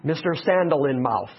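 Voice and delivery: ordinary delivery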